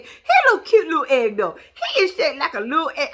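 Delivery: giggling through the words; can't stop laughing at the egg shape. Real, not acted.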